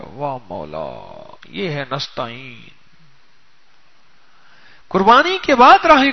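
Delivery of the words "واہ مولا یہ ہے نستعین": low, slow awed exclamation; hushed wonder. Retold or imitated. Servant discovering the hole in the fort, slow and awed. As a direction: marvel quietly at the secret.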